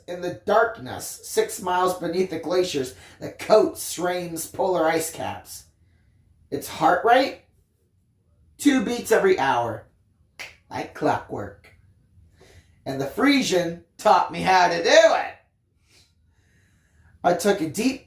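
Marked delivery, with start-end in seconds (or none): none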